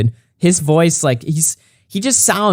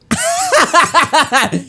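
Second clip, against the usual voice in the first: high-pitched burst of laughter; laughing; 11 semitones higher than usual. Real, not acted. Streamer reacting to his rival's video, laughing.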